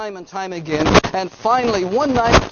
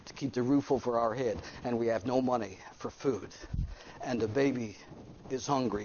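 retold hardship; 6 semitones lower than usual. Hard-luck father tells his story.

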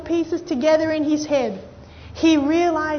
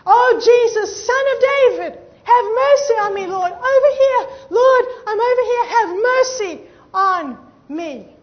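loud, high cry for mercy; acted-out blind beggar shouting urgently; desperate shout; 6 semitones higher, 7 dB louder than usual. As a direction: call out to be noticed over a crowd. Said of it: "he begins to shout".

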